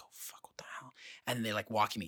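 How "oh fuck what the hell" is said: hushed, whispered dread; panicked whisper. Retold or imitated. Crew member called to the front, panicked whisper. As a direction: whisper it to yourself in retold alarm. Retelling panic at being summoned.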